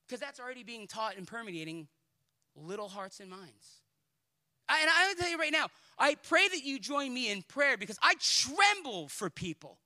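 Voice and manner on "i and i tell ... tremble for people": loud, high, impassioned appeal; trembling-for-souls urgency, full voice; fervent preaching. Real, not acted. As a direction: preach it urgently at raised pitch.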